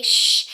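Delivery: loud, sharp "shh"; hushing shush. Acted. Friend quiets someone being too loud, firm shush. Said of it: "your friends had to say".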